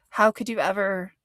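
usual speaking voice

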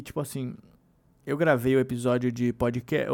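ordinary talk

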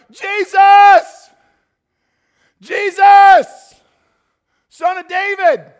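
full-force, very high, drawn-out shouts; desperate, stretched-out yell; yelling into the dark. Acted. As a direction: holler blindly to someone far away. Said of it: "he just yells into the dark".

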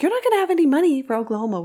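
exasperated retold telling-off; scolding. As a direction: nag him in a rush, imitating her. Voicing her scolding him.

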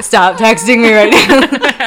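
laughing plea. Retold or imitated. Retold exasperated reply, laughing.

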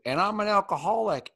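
P=A; heckler's quip. Retold or imitated. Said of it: "And then someone said".

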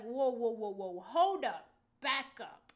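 loud, halting disbelief; acted-out alarmed protest; startled interruption. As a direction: put the brakes on the conversation, loudly. Retelling her startled interruption of dad.

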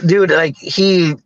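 ordinary delivery

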